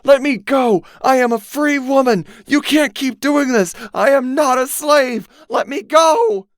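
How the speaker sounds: high, loud furious shriek; building frantic shriek; crying out. A narrator's reading. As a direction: scream defiance at her captors. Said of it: "the woman cried out".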